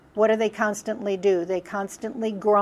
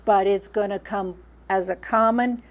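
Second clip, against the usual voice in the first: usual speaking voice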